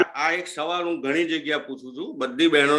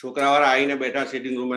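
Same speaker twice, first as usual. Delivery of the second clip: ordinary talk, nothing marked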